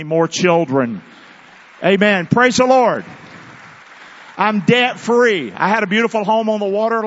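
loud, rising praise shout; preacher shouting praise to the church; joyful shout. Real, not acted. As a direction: belt it out in triumph.

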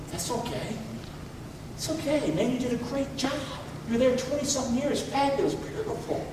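very soft, lifted consoling voice; hushed, tender retold encouragement; gentle reassurance. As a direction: comfort a hurting friend almost under your breath. Retells consoling his grieving friend.

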